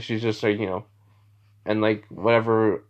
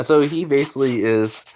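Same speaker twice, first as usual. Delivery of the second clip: plain reading voice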